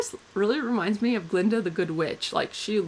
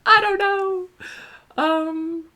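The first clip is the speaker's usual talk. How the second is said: laughing; 11 semitones higher, 3 dB louder, much slower than usual. Real, not acted. Laughing at her own story.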